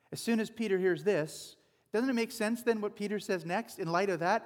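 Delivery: normal talking voice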